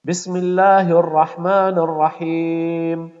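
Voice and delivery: ordinary delivery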